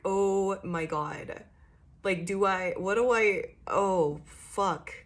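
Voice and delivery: flustered, losing the thread; mock panic. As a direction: stumble through a mock panic. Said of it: "like, panic".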